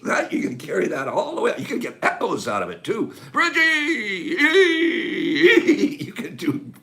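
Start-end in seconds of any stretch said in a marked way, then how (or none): 3.6-6.0 high loud helpless laugh; cracking up. Real, not acted. Host cracking up over the name, helpless laugh. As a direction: break into giggles. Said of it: "I don't know why I'm cracking up".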